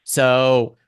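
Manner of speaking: ordinary talk, nothing marked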